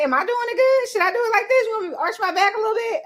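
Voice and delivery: playing the eager lover checking in; eager partner in bed. A man asking his girlfriend how he's doing, in a high eager voice. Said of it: "Because I might say".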